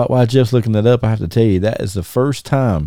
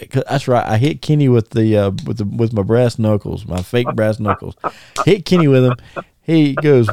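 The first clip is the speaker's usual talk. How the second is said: normal talking voice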